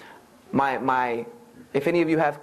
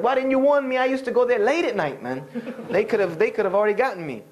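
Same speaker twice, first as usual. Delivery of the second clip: higher, half-joking alarm; hammed-up outrage at not being warned; mock panic; 7 semitones higher than usual. Telling grandmother she should have warned him, mock panic.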